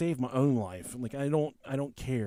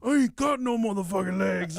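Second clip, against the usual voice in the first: loud, raised indignant retort; imitated yelling; 6 semitones higher, 5 dB louder than usual. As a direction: yell back, mimicking an exasperated victim. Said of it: "they're fucking yelling at each other".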